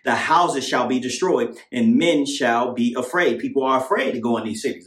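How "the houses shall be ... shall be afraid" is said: slow, weighty reading of verses; slow scripture reading. Acted. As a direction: read the verse out slowly.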